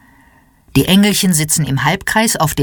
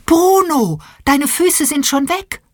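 excited call; 10 semitones higher than usual. A narrator's reading. Teacher excitedly calls to Bruno.

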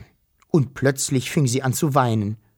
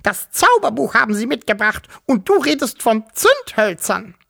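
high, indignant piping; piping old-granny scolding; squeaky grandmother; 9 semitones higher, 3 dB louder than usual. A narrator's reading. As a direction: squeak your outrage at the uncle. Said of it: "piepste die Wawuschel-Großmutter".

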